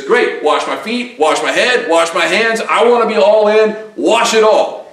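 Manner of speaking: an all-in disciple growing excited; eager Peter. Retold or imitated. Does a total one eighty.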